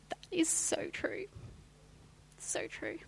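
voiceless whisper, deeply moved; awed whisper. Real, not acted. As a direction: let it come out as a breathless whisper. Moved reply to God's words.

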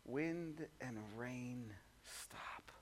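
slow, hushed command, "stop" breathed out; calm command. Retold or imitated. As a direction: still the storm with quiet authority. Jesus commands the storm.

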